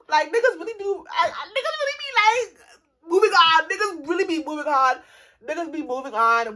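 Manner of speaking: loud, high, half-crying complaint; exasperated wail about men; laughing rant. Real, not acted. Ranting about men moving on.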